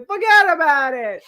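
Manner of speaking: put-on loud, high New Jersey Italian accent. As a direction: exclaim it as a hammy Jersey Italian. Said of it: "You're like a New Jersey Italian".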